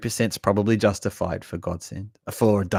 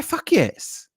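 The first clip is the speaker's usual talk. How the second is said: enthusiastic recommendation; 11 semitones higher, 3 dB louder, much slower than usual. Retold or imitated. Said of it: "You say".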